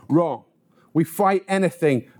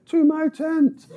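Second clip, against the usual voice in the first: much higher, mock-innocent reply; 10 semitones higher, 3 dB louder, slower than usual. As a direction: play it sweet and innocent, high.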